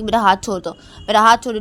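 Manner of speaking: angry demand. Acted. Wife pulls her hand away.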